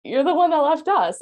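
retold angry retort. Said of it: "And I'm like".

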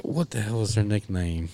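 ordinary talk, nothing marked

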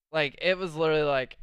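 usual speaking voice

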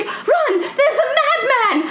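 very high, hammed-up cry of alarm; alarmed French woman. French woman warning children about the painter, high mock-panicked cry. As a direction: shriek a comic warning, drawing it out. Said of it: "the French woman's like".

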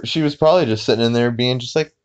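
ordinary delivery

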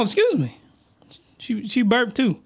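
ordinary delivery

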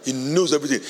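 usual speaking voice

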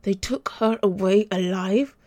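slow, furious demand; angry shout. A narrator's reading. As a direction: bite out each word. Enraged follow-up question.

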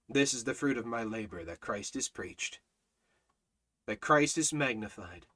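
low, soft reverent reading; hushed scripture. Acted. Preacher reading Paul's words low and soft. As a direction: read it softly, almost to yourself.

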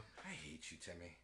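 very low, very soft curse; muttered mock grudge. Host reacts to the kid's bad joke, muttered grudge. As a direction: grumble hatred under your breath.

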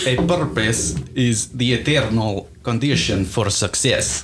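slow Russian accent; slowly, in a Russian accent. Shown as a demonstration. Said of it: "Do Russian".

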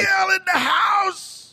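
high drawn-out shout of a name; mock hype call. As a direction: announce her like a ring MC. Co-host mock announces, shouting.

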